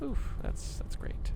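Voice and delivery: whispery, voiceless exhale of words; rattled whisper after a close call; shaken relief. Real, not acted. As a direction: let it out on a relieved breath. Shaken relief after near miss.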